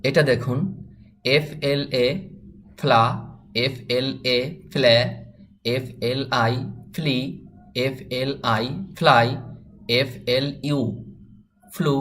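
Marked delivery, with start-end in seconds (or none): 1.4-7.2 letters spelt out slowly, then the word; slow teacher spelling drill. Shown as a demonstration. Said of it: "আরেকবার বানান ছাড়া পড়বেন". 7.9-11.9 letters spelt out slowly, then the word; slow teacher spelling drill. Shown as a demonstration. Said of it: "আরেকবার বানান ছাড়া পড়বেন".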